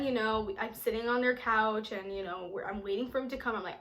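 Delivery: usual speaking voice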